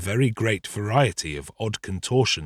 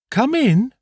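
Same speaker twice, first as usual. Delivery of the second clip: put-on high, louder old lady calling; 5 dB louder than usual. A narrator's reading. Old lady answers a knock at the door, calling out high. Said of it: "said the old lady".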